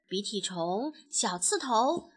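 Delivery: ordinary delivery, nothing marked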